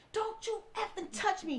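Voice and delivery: high, sharp imitation of an enraged man; retold angry snap. Husband recoiling from his wife's hand, a mock angry snap. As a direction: throw it off like he can't stand being touched. Husband snaps when touched.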